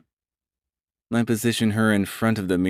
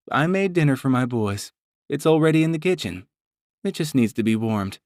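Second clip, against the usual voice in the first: higher motherly voice, a little teasing; Mama voice; 7 semitones higher than usual. Acted. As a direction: play the doting mother chiding her son. Mama speaking to sons.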